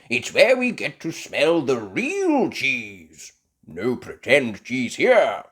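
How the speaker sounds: loud, slow boasting; a hint of a proud grandpa; lightly voiced Grampy Rabbit.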